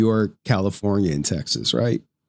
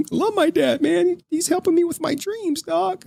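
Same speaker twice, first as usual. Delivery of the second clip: mock whiny voice. Mock voicing the streamer.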